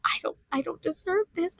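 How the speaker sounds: hushed whisper of disbelief. Retold or imitated. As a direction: breathe it out in a whisper. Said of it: "he's just like".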